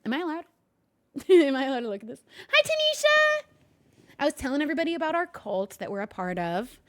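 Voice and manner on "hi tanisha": high loud squeal; excited squeal. Acted. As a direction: squeal the name happily. Greeting in a squeal.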